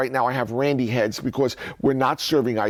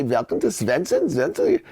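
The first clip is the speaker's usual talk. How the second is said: hint of a put-on Swedish accent; imitated accent; 5 semitones higher than usual. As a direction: imitate the Swedish-style shop greeting. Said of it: "were the best with the accent".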